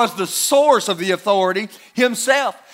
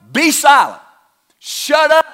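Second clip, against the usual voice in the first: higher, louder rebuke; stern command; 5 semitones higher, 5 dB louder than usual. Retold or imitated. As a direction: order the demon to be silent. Jesus commands the demon.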